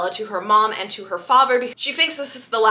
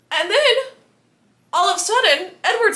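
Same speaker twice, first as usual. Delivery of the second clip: dramatic buildup; 9 semitones higher than usual. Real, not acted. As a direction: build suspense like a storyteller. Dramatic turn in the recap.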